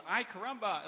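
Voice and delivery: put-on a boy's loud yell cannonballing into the pool. Retelling the boy's yell as he jumps into the pool, loud. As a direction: shout it out as he leaps in. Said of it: "like, real loud".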